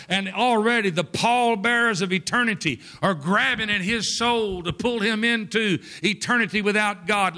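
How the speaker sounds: ordinary delivery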